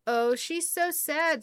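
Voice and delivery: high, drawn-out pitying coo; sympathetic pity. A narrator's reading. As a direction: feel sorry for the little one, slowly. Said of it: "and felt bad".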